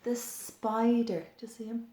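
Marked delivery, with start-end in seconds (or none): none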